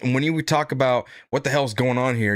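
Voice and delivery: ordinary delivery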